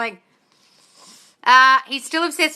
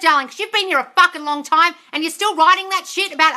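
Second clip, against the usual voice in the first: much higher, louder scolding yell; 6 semitones higher, 6 dB louder than usual. Real, not acted. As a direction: lay into her at full volume. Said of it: "for yelling at you".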